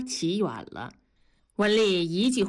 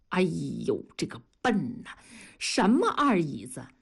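lightly scoffing at his dimness; mocking; 2 semitones lower than usual. A narrator's reading. Coworker teases the husband for missing the point, mild mockery. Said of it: "大庄嘲笑道".

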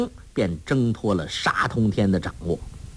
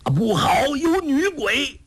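acted panicked shriek, pitched way up; alarmed cry. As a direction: cry out in terror at a ghost. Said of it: "齐声惊呼".

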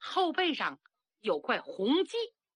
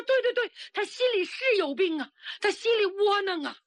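put-on old consort agreeing in a high, loud voice; eager old consort; 10 semitones higher, 4 dB louder than usual. The old consort to the girl healer, confirming the princess's grief, high and loud. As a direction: nod along fast and pour out his worry, pitched high. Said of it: "老驸马说".

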